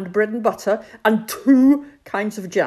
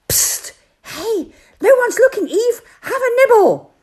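much higher, sly coaxing hiss of a snake; tempting snake; 9 dB louder than usual. Retold or imitated. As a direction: lure Eve in like a sneaky serpent. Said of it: "was the one who said".

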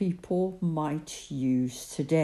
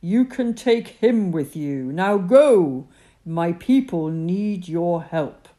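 God's voice; 9 dB louder than usual. Acted. God reassures Moses.

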